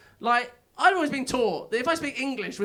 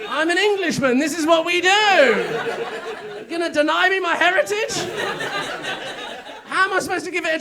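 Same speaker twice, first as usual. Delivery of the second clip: mock indignant; 6 semitones higher, 5 dB louder than usual. Comic Englishman loudly demanding his plundering heritage, in mock outrage. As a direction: bluster like a wronged Englishman.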